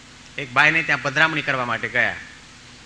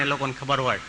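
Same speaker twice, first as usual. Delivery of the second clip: normal talking voice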